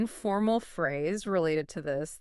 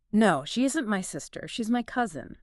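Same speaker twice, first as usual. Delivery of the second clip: natural conversational pace; a little faster; 2 semitones higher than usual. Shown as a demonstration. Teacher modelling the sentence more naturally, a little faster. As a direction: flow through it without breaks. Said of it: "saying it a little faster, more naturally".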